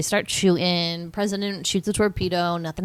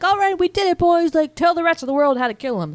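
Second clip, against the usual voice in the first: high mock cheer; 9 semitones higher, 4 dB louder than usual. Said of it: "they're like".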